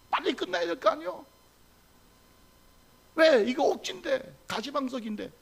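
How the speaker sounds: high, fidgety mock complaint; impatient grumbling. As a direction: act out someone itching for the service to end. Acting a restless worshipper grumbling. (높은 소리로 안달하며 투덜대기)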